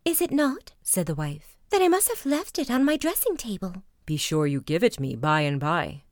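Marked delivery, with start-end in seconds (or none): 0.1-0.7 higher, lightly nervous wife; young wife's voice. A narrator's reading. Wife feigning innocence to her husband, higher voice. 1.7-3.8 higher, lightly nervous wife; young wife's voice. A narrator's reading. Wife feigning innocence to her husband, higher voice. Wife lying about the key. 4.1-6.0 lower, mildly stern husband; Bluebeard's voice. A narrator's reading. Husband demanding the key from his wife, low and stern.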